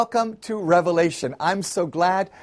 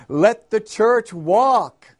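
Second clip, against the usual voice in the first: drawn-out, raised preacher shout; preacher's shout; 5 semitones higher, 5 dB louder, much slower than usual. A narrator's reading. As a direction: call it out slowly, higher and louder. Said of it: "he shouted".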